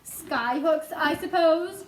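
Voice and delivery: jeering. A narrator's reading. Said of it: "jeered the centipede".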